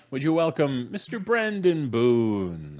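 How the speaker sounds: slow, grand game-show welcome; announcer intro. Acted. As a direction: roll out the name like a showman. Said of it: "host announcing guest".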